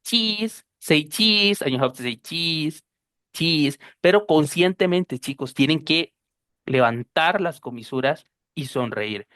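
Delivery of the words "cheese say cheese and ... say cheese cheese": "cheese" with a long smiling I. Shown as a demonstration. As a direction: say "cheese" through a grin. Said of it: "Sí o sí hay que sonreír". ("cheese" con sonrisa e I larga)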